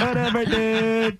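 high, slow lazy stoner drawl. Retold or imitated. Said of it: "The stoner on".

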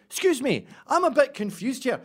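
polite Scot lost abroad; Scottish tourist. Pretend. To the subway dancers, a high Scottish accent. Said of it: "I'm just in for Scotland".

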